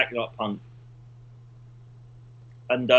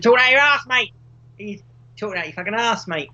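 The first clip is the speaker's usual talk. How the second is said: put-on high, loud Norfolk old woman scolding; broad Norfolk yokel telling him off; Norfolk accent; 7 semitones higher, 4 dB louder than usual. Old Norfolk woman heckling Copernicus, high and loud.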